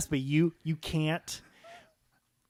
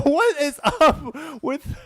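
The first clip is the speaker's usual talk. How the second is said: laughing; 10 semitones higher, 6 dB louder, much slower than usual. Real, not acted.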